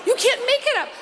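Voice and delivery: normal talking voice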